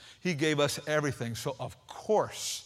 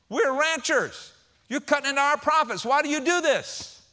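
higher and louder, building frustration; exasperated son; 8 semitones higher, 5 dB louder than usual. Acted. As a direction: scold your dad about the money. Son confronts dad over losses.

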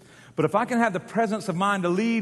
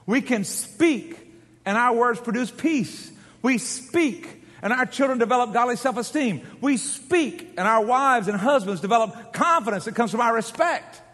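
rising preaching; 4 semitones higher than usual. Acted. Building sermon refrain.

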